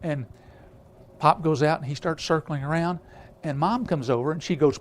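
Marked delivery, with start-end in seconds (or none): none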